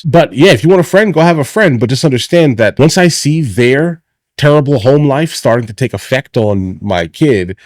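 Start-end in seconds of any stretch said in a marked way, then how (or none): 0.0-1.8 loud sharp voice. Real, not acted. Heated parenting rant.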